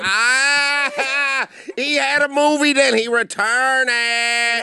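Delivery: sassy woman voice. Acted. S0 in character as woman.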